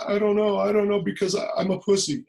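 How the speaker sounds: high-pitched mock tearful whine; imitated crying. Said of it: "I was just crying like".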